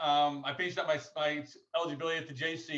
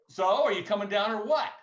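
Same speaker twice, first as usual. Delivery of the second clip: louder, higher retold challenge; pressing demand; 6 semitones higher, 7 dB louder than usual. Coach pressing recruit for his answer, imitated loud demand. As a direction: push the question, imitating an insistent coach.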